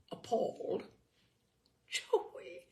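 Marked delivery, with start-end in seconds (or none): 1.9-2.7 faint high whisper; dying whisper. Acted. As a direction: whisper his name with your last strength. Dying mother whispers her wish.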